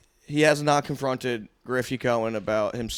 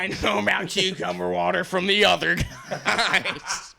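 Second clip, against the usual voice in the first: slow, higher mimicry breaking into laughter; mocking friend's voice; 5 semitones higher, slower than usual. Teasing a friend about where he learned it, drawn-out mimicry. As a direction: draw it out in your friend's put-on voice. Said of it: "that's how I talk".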